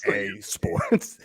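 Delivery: low, soft promo voice; game announcer attempt. Host trying the tagline after coaching, low announcer voice. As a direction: try a deep sports-game tagline. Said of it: "Let me try".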